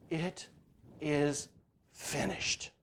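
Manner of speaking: slow, soft whisper with long pauses; whispered quote. Retold or imitated. Preacher quoting his wife's words to the congregation, whispered slowly. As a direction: whisper each word apart, soft.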